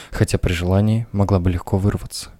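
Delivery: ordinary delivery, nothing marked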